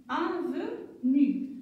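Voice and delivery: "Avenue" said again slowly; slow repeat. Shown as a demonstration. Teacher repeats "Avenue" slowly for pupils. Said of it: "on va les lire encore une fois".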